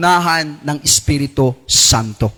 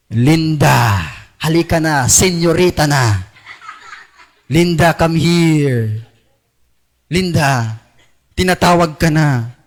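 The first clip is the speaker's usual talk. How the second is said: low, coaxing voice of the flesh luring Linda; tempting whisper voice; 5 semitones lower, slower than usual. Acted. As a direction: lure her in, low and sly. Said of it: "Kahit bumubulong sa'yo". (a hint of a tempter calling Linda with a laugh)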